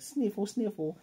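high-pitched spoken sniffles; cutesy, put-on sniffle; mock sniffling. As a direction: imitate a pitiful little sniffler. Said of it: "Anya's sniffling".

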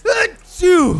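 loud violent sneeze; a big sudden sneeze; sneezing. Real, not acted. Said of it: "That's violent".

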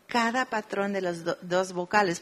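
usual speaking voice

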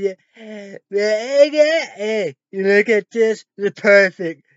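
loud, high gushing as dad; imitating dad gushing. Quoting dad praising Trump, loud mock gushing. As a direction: gush over him like an excited dad. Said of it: "Dad said".

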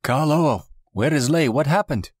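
high-pitched frantic questioning; urgent high voice of alarm; acted alarm. A narrator's reading. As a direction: cry out for answers, pitched well up. Frantic cries as Lei is missing.

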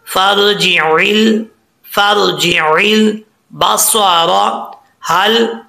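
slow, drawn-out recitation for students to repeat; Quran recitation. Shown as a demonstration. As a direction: recite the line slowly so they can follow. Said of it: "आपने मेरे साथ साथ पढ़ना है". (धीमी तिलावत, शागिर्दों के साथ साथ पढ़ने के लिए)

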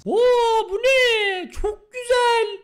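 very high, loud, drawn-out delight; amazed squeal. Real, not acted. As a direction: squeal in awe at what you see. Amazed reaction to the car.

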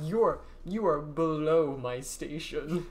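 slow, hammed-up snobbish put-down; haughty rejection. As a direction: play a haughty man looking down on a suitor, drawn out. Imagined arrogant man turning speaker down.

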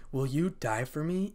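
plain reading voice

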